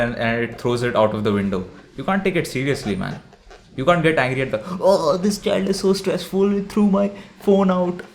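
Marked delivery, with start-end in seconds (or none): 4.8-8.0 much higher, slow, whiny complaint; hammed-up parent fussing over a thrown phone; mock whiny parent. Mocking a parent whining that the kid threw the phone, high slow whine.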